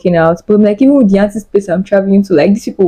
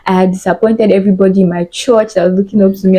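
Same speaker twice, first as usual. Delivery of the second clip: ordinary talk, nothing marked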